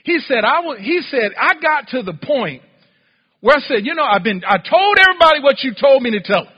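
loud, high, sustained impassioned shouting; preaching at full force. Acted. Preacher acts out the prophet pleading his case to God, preaching forcefully. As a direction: pour out the prophet's protest at full volume.

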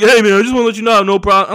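fast, slightly put-on conciliatory voice; imitated placating comic. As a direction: rush to smooth things over, imitating him. Voices other comic placating him.

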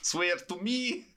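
slow, high, laughing Batman parody; hammed-up Batman. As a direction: laugh through the Batman growl. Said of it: "with a Russian accent".

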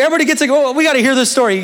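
high, eager rallying call; retold excited coach. Coach gathering players to hear the story, excited. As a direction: imitate a coach calling everyone in.

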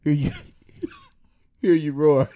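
shouted, laughing "hear you roar"; loud slow laughing take; loud laughing line. Pretend. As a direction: belt it out through laughter. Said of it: "let's try it again".

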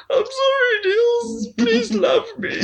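very high, whimpering mock plea; mock pleading. Apprentice begging the mentor, mock sobbing plea. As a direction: beg pathetically like a crying kid. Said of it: "And you're like".

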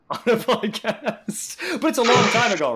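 laughs through the words; giggling while speaking; laughing. Real, not acted. Laughing while teasing the guest.

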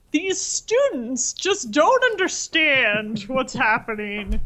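slow, drawn-out mock grumbling about the young; crotchety old man. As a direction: imitate a crotchety father, stretching out the words. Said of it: "my father has become a crotchety old man".

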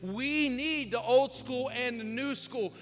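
loud, high preaching build; fiery preaching. Acted. Pastor preaching hard to the church, loud and high. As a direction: preach it out to the congregation.

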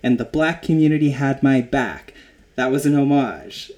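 loud indignant denial, retold; mock explosive retort. Host retelling Joy's furious reply to Kim, loud and indignant. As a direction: blast it back, hammed-up. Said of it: "Joy explodes back at her".

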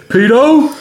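high, loud hail from afar; imitated shout. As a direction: shout the nickname up to someone, mimicking. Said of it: "he'd shout up and say".

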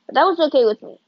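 ordinary delivery, nothing marked

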